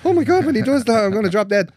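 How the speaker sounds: high, loud mock panic as a scared client; mock panicked client. Nervous future client mimicked in a high, loud panicked squeal. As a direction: squeal it like a client terrified of dropping dead. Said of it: "all my future clients going".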